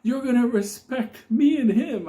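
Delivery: high indignant warning; the Unabomber defiant. Retold or imitated. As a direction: lay down the law in a high strained voice. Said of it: "He goes".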